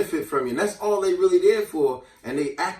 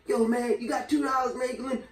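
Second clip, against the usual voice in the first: moocher voice; 6 semitones higher, 5 dB quieter than usual. Retold or imitated. Moocher asking money.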